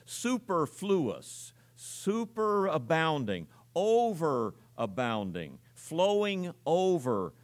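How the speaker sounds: drawn-out preacher's emphasis, term by term; slow, emphatic. Real, not acted. Preacher hammering home a word's meaning.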